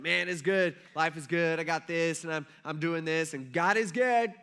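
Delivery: loud, put-on cheery bragging; fake all-is-good act. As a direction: overdo the upbeat front like a phony friend. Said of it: "putting on a show".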